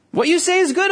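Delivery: high, eager take on "What you say is good"; "What you say is good" pitched high and keen as a confident crowd; eager agreement. Retold or imitated. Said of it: "I think the people thought that".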